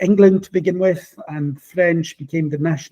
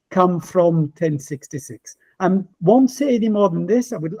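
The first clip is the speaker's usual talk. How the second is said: ordinary delivery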